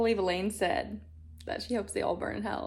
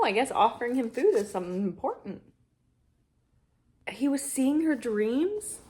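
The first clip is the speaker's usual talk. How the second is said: ordinary delivery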